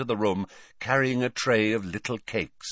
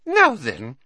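put-on louder, high happy old lady; 8 semitones higher, 6 dB louder than usual. A narrator's reading. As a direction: say it with glee. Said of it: "said Hepzibah happily".

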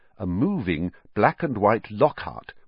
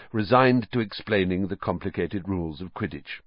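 ordinary delivery

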